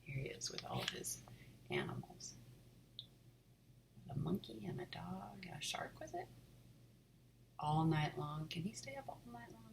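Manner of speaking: hushed reading aside; whispering. Real, not acted. Reader whispering asides to child.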